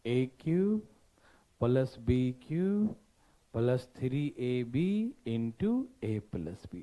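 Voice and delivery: slow, drawn-out recital with pauses; formula dictation. Real, not acted. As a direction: recite the formula slowly, piece by piece. Dictates formula again, slowly. (धीरे-धीरे रुक-रुककर सूत्र बोलते हुए)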